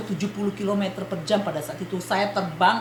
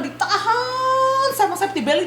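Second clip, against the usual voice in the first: drawn-out strain; 10 semitones higher than usual. Retold or imitated. Acting out being held by belt.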